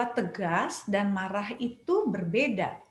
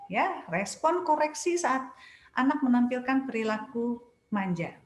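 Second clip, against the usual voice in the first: ordinary talk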